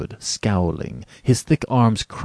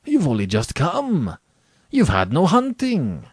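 hurt, high-pitched protest; 9 semitones higher, 3 dB louder than usual. A narrator's reading. General protesting that his guest must stay, hurt. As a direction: plead with swooping, raised pitch. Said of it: "the general protested".

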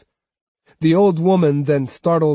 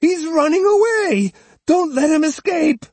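very high, loud alarm; crying out; 5 dB louder than usual. Acted. Old woman shouting to the crowd, high and loud. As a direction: rouse the crowd after him. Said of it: "Osugi cried".

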